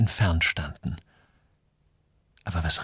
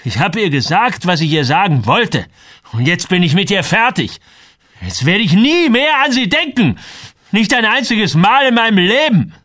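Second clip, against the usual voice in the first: heated, climbing to a high shout; agitated vow; 10 semitones higher, 6 dB louder than usual. A narrator's reading. Samuel swears he is finished with Jenny, shouting in agitation. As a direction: build to a loud, furious finish. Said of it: "Samuel war so erregt".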